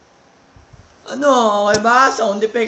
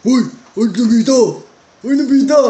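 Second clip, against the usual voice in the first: cartoon call; 3 semitones higher, 8 dB louder than usual. Doraemon call.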